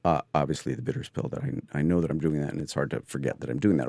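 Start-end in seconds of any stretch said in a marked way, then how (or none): none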